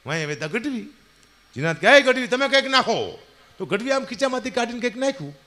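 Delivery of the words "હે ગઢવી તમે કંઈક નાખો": jinn calling out. Acted. Jinn calls out to Gadhvi.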